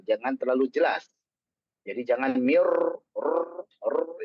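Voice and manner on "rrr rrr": "rrr" as a soft prolonged trill; a buzzing r held too long; rolled r, wrong. Shown as a demonstration. Teacher showing the wrong trill to students. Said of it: "ini salah".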